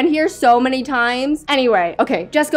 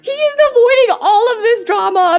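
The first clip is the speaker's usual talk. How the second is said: exasperated disbelief; 11 semitones higher, 3 dB louder than usual. Real, not acted. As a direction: exclaim high at his nerve to skip the drama. Finds William ignoring the drama.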